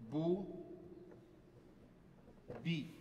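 "Bu," then "Bi." each set apart by a long pause; a teacher repeating the vowels slowly, spaced syllables; slow vowel drill. Shown as a demonstration. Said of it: "Also B".

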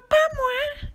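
very high mewing cat. A narrator's reading. Cat refusing to help the hen, high mewing voice. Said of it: "dit le chat".

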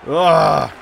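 short shouted ugh; angry groan; loud frustrated groan. Real, not acted. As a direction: grunt of annoyance. Groans at bad play.

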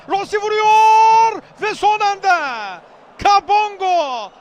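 loud, slow drawn-out shout; screaming football commentator. Real, not acted. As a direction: roar as the shot goes in. Near-goal chance in commentary.

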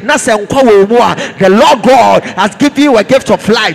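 loud, driving Pentecostal sermon; fervent preacher rousing the congregation; full-force preaching. Real, not acted. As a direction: preach it hard in short, punchy phrases.

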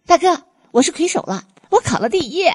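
high-pitched gleeful announcement; bubbling, excited boast; overjoyed. Acted. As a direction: burst out with your good news. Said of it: "迫不及待地将喜悦之情分享给了他".

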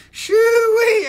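high, loud "shoo-wee"; loud high-pitched holler; whooping holler. Pretend. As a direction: burst out with a country whoop. Whooping between accent bits.

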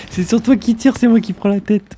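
laughing while talking; high laughing complaint. Real, not acted. Laughing at being shot first.

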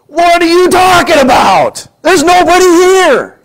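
high, loud, disbelieving outburst; incredulous servant. Retold or imitated. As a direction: yell it in disbelief, slowly. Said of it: "he goes".

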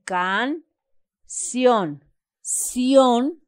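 canción split into can, then cion; syllable by syllable. Shown as a demonstration. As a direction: break canción apart with a clear pause, punch cion. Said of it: "separamos en sílaba, quedaría así".

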